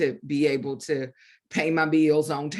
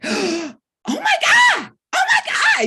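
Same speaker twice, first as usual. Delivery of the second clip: much higher and louder, a gasping cry of disbelief; stunned shout; 9 dB louder than usual. Retold or imitated. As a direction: shout it out, overwhelmed with shock. Retelling her shock at Oprah's call.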